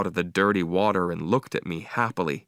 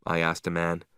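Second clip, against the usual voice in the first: ordinary delivery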